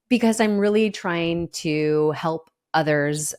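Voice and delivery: ordinary delivery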